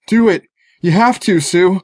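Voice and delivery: high-pitched, urgent plea; crying out. A narrator's reading. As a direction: cry out urgently to persuade. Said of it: "but Zeke cried out".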